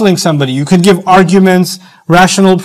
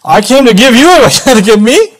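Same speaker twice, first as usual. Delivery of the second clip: indignant, incredulous, pitched up; wounded ego; 6 semitones higher, 4 dB louder than usual. Acted. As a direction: act affronted at being advised in return. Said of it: "if your ego sparks and my ego sparks".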